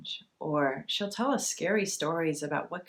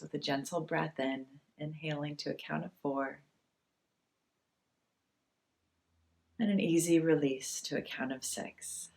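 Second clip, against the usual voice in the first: guided meditation. Acted. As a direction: ease them into calm, one breath at a time.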